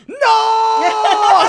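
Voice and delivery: sudden high yelled "No!" with a laugh; hammed-up yell of despair; shouted no. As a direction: cry out in dismay, laughing. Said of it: "Dominic goes".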